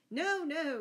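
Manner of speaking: high, loud, mildly amused protest; laughed protest. A narrator's reading. Robin stops his men ducking the stranger, amused high call. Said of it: "laughed Robin".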